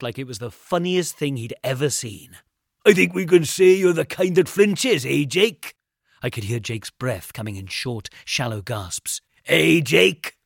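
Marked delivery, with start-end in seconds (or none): 2.9-5.8 loud, higher crowing taunt; gloating, raised voice; mocking. A narrator's reading. Bully crowing after scare. 9.5-10.4 loud, higher jeer; goading call; mocking. A narrator's reading. Bully keeps taunting.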